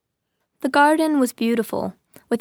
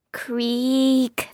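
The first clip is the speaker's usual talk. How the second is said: creaking gate. A narrator's reading. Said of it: "the cat heard the gate warn him".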